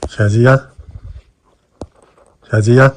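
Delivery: loud calling. Acted. Man calling loudly to wake the sleeping woman. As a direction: call out loudly to wake her.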